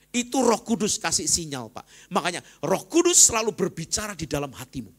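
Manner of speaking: loud, high preaching climax; fiery sermon peak; preaching at full force. Acted. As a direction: proclaim it to the whole church. Preacher raises voice.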